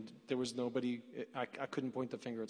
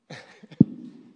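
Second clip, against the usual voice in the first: breathy, tearful laugh; a laugh that breaks into emotion; loud emotional half-laugh, half-sob; choked-up laugh; 13 dB louder than usual. Real, not acted. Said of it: "I'm supposed to be the emotional support".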